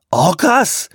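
high, loud startled question; alarmed. A narrator's reading. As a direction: blurt it out in alarm.

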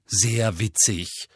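slow, drawn-out snappish retort; snarled. A narrator's reading. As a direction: spit it out, annoyed and slow. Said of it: "fauchte Harry". (gereizt gefaucht, gedehnt)

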